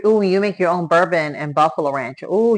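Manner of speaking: ordinary delivery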